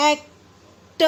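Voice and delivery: "एक्ट" split in two, a pause before a separate "ट"; broken into pieces. Shown as a demonstration. Teacher showing how not to say "एक्ट", broken in two. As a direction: break "एक्ट" into "एक" and a detached "ट". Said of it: "ऐसे नहीं बोलेंगे हम".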